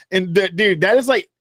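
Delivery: normal talking voice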